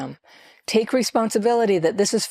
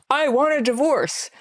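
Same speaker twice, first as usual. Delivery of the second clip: high, dramatic quoted outburst; mock rash pronouncement; 8 semitones higher than usual. Advising against big pronouncements like this, mock dramatic outburst. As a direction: act out a hasty threat, pitched up.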